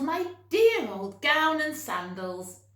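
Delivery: high, loud and slow with joy; fond happy cry. A narrator's reading. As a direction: cherish each word out loud. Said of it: "he yelled".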